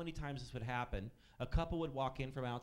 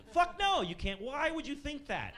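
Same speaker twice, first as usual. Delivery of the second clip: much higher, loud sudden yell; mock outburst; 12 semitones higher, 5 dB louder than usual. Waiter blasting the customer's egg request, high yell. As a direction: blurt the refusal high and sharp.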